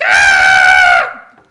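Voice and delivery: loud hammed-up roar of rage; enraged shout. Commandant raging at the prisoners, shouted. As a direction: blast out a furious yell.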